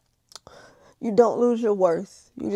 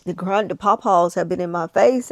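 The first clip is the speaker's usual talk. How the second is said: normal talking voice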